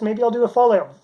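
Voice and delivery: normal talking voice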